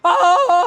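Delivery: loud, high yell of pain; sudden yelled "ah" as the creature. Acted. Game master voicing the stabbed creature, yelling. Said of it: "why am I yelling?".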